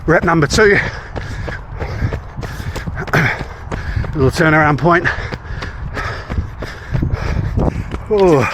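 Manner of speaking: breathing hard mid-run; while running. Real, not acted. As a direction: talk while jogging hard. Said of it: "I'll try running, holding a camera".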